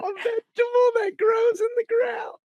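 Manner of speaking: high-pitched, squealed disbelief; incredulous outburst. Real, not acted. Co-host questions her nightshade explanation, high incredulous squeal. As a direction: echo it back way up high, incredulous.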